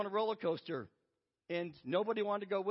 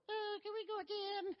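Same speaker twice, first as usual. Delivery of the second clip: put-on high, softer child's voice; imitated little girl; 10 semitones higher, 5 dB quieter than usual. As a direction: plead like an eager little kid. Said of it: "here's this little girl".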